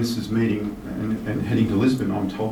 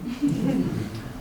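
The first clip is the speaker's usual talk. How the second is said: short laugh; 12 semitones higher, 4 dB quieter than usual. Real, not acted. Laughs at cold Lake Bled.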